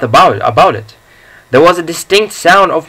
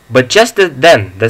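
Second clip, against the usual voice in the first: ordinary delivery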